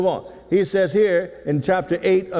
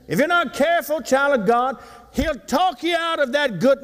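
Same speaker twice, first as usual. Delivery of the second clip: rising preacher warning; 9 semitones higher than usual. Acted. Repeats the warning, pitched higher.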